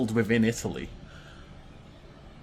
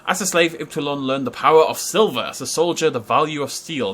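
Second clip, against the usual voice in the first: lore reading; 5 dB louder than usual. Acted. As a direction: narrate the legend like a documentary. Reads game lore aloud.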